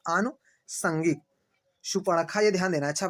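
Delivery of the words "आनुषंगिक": slow drawn-out model word. Shown as a demonstration. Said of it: "क्या होता है?".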